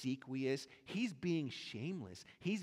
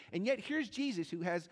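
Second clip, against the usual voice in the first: ordinary talk, nothing marked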